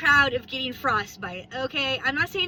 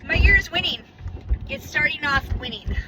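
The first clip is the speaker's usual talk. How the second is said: ordinary talk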